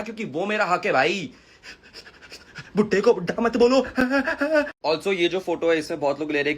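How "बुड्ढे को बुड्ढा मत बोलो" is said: higher-pitched mock complaint; mocking imitation. Creator parrots those objecting to age-shaming, mocking. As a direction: parrot the critics in a put-on high voice.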